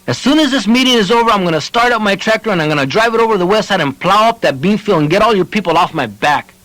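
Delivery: high, fast angry threat. A narrator's reading. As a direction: threaten in a temper. Joe threatens to plow.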